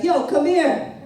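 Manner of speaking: high, loud imitated cop's holler; yelling to partner. Cop summoning his partner over, yelling. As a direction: call your partner over at full voice. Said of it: "he yells to his partner".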